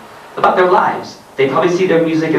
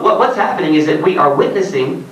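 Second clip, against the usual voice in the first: usual speaking voice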